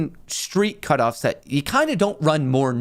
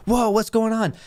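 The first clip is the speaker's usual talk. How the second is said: higher-pitched, mildly frantic retelling; imitated frantic woman; 8 semitones higher than usual. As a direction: act out her alarm, lightly. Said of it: "They were frantic".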